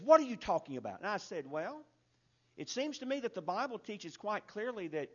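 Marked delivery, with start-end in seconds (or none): 0.0-1.0 loud burst of indignant disbelief; indignant challenge. Retold or imitated. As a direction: throw the question back at him, loud and offended. Acts out angry woman's challenge.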